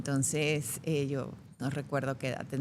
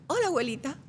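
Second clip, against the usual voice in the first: put-on high, sweet child greeting; little girl innocently saying hi mid-tantrum; lightly voiced cute child; 10 semitones higher, faster than usual. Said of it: "le digo".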